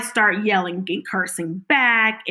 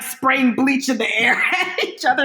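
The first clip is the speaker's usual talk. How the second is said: laughing; 5 semitones higher, 3 dB louder than usual. Real, not acted. Laughing while telling bleach fight.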